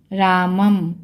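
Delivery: रामम ending on a held "m"; anusvara as m. Shown as a demonstration. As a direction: hum the last m of रामम. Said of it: "तो म की आवाज़ आएगी". (रामम के अंत में "म" की गूंज)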